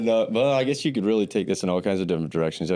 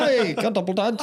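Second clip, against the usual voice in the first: put-on high, lilting Irish accent; Hey said with an Irish lilt; 13 semitones higher than usual. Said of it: "like, an Irish accent?".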